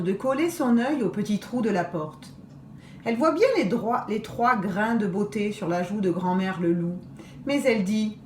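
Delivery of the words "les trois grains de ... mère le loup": plain reading voice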